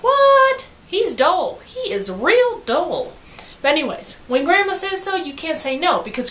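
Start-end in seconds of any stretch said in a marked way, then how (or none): 0.0-3.1 a high loud "What?" then slow and deflated; sudden outrage sinking into a slow complaint; shocked disbelief. Retold or imitated. Janie objecting to her grandma's choice, high then slow. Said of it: "Janie's like".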